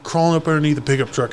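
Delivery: ordinary talk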